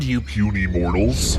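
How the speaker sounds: low, slow booming immortal; immortal being voice. Pretend. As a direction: lord it over puny mortals, slowly. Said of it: "I'm an immortal being".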